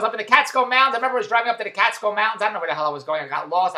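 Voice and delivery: usual speaking voice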